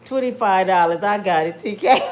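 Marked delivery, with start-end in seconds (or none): none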